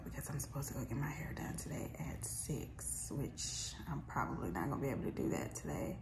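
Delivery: hushed whisper, people in the house. Real, not acted. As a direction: keep it to a whisper. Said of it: "so I'm whispering because I got people".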